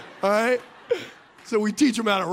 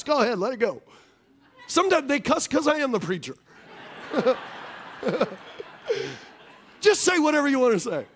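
ordinary delivery

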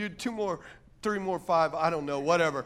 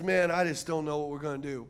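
low, subdued confession; discouraged; 8 semitones lower, 5 dB quieter than usual. Acted. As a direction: say it low, as if out of ideas. Acting a person without hope.

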